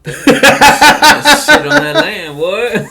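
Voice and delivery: loud, high laughing outburst; laughing shout. Real, not acted. Reacting to the bucket toilet idea.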